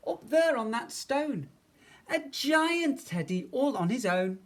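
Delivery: put-on high, excited little-boy voice; amazed child. A narrator's reading. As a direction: marvel at it in a high voice. Eddie spots the giant teddy.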